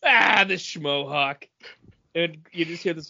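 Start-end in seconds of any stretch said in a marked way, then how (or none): none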